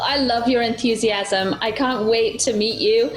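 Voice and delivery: ordinary delivery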